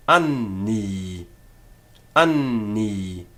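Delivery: "anni" with the n held across both syllables; doubled N. Shown as a demonstration. Teacher showing the double consonant, "anni" with held n. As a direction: linger on the nn of "anni", long final i. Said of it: "The first an in the first syllable".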